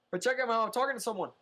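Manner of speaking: loud, high call across the room. Real, not acted. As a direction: raise your voice to someone nearby. Calling to someone off-mic.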